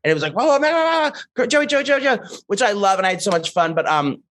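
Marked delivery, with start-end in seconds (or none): 0.3-2.2 high, excited crowd voice calling his name; fans calling out. Retold or imitated. Fans at the Boston bar mobbing Joey, excited high calling. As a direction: play a fan spotting him across the bar.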